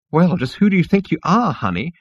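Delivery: high, huffy insect retort; flouncing rage. Acted. Outraged insect receptionist challenges Zaphod, huffy. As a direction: flounce back indignantly. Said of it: "quivering its wings in rage".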